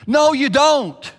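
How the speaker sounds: high, loud and slow; forceful denial. Real, not acted. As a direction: shut the excuse down hard. Preacher rebuts congregation forcefully.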